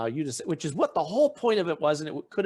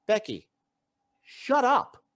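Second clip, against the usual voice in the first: higher, louder retold rebuke; telling Becky to shut up; 6 semitones higher, 4 dB louder, slower than usual. Guy sitting between them silencing Becky, raised and high. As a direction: cut Becky off sharply. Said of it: "the guy sitting between them goes".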